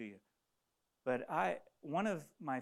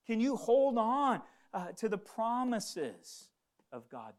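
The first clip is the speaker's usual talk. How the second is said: high, raised exhortation, building in force; impassioned preaching; 7 semitones higher, 5 dB louder than usual. Real, not acted. Preacher urges brothers and sisters to persevere, fervent. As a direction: stretch the key words and press the appeal.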